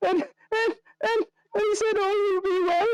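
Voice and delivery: very high squeaky voice through giggles; helpless laughter. Acted. Irina retells the burgomaster's failed torch lighting, helplessly laughing. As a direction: stammer and restart, keeled over laughing. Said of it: "nearly just kind of keels over".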